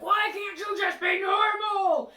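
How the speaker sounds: put-on furious high shout at the kid, dragged out; mom screaming. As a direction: scream it like a fed-up mom losing it in the car. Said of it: "She's like".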